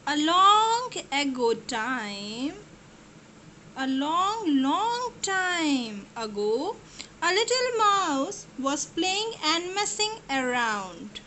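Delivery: slow, soft story narration; gentle read-aloud to kids; storytelling voice. A narrator's reading. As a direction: draw the children into the tale. Storytelling opening.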